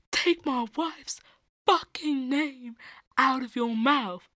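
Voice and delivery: high, slow mock shout from the seat. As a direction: imitate the furious yell, hammed-up. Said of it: "he's like".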